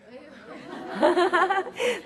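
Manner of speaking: loud amused laugh; loud high laugh. Real, not acted. Audience reacts, speaker laughs.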